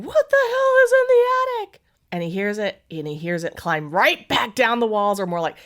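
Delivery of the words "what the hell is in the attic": high, loud, laughing cat squeal. Pretend. Owner voicing the cat demanding answers, squealing. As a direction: voice the cat yelling up at the attic. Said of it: "why isn't she sitting here going".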